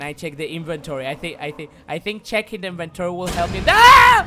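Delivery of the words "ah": piercing high scream; startled scream. Real, not acted. Gamer screaming as enemy grabs him. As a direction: shriek at the jump scare. Jump scare.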